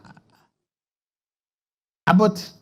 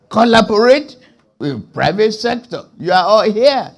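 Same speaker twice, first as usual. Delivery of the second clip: rousing call-out; 3 semitones higher, 7 dB louder than usual. Real, not acted. Rousing the audience in a speech.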